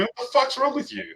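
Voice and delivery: a mild mock of his gruff dad, pitched high; imitating old man. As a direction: toss it off like the old man brushing him aside. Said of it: "My old man would say to me".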